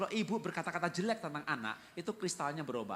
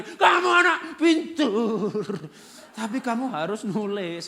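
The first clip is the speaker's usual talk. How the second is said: loud, higher, very slow shouted praise; shouting; 8 semitones higher, 13 dB louder, slower than usual. Acted. Parent yelling at the child who will not write. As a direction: bellow it at the child. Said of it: "kita teriak".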